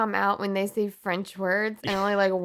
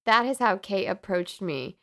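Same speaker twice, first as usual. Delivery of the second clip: ordinary delivery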